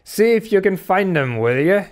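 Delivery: high-pitched, teasing stranger's voice; Elijah. A narrator's reading. Stranger touching a sailor's shoulder, high and knowing. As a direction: needle them with a high, knowing question. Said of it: "he came softly after us".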